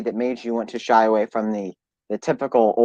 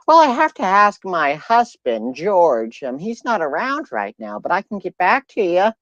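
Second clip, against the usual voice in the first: put-on old lady voice; 6 semitones higher than usual. Woman stringing a telemarketer along as a high old lady. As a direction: chat on sweetly, pitched up. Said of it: "the old lady voice".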